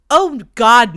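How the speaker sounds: a loud, scoffing oh God; mock shocked cry. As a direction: blurt it like the idea is absurd. Friends reacting in disbelief.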